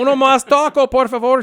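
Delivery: high, loud tourist Spanish; bad gringo Spanish. Ordering tacos in Mexico in clumsy tourist Spanish. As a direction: Uno más taco ordered like a clueless tourist. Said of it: "I'm like, Spanish".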